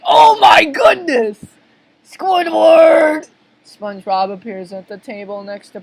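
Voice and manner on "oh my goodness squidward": high, loud horrified holler; outraged bellow; alarmed shout. Acted. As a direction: yell in shock, drawing out the name. Plankton yells for Squidward in shock.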